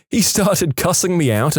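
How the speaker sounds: plain reading voice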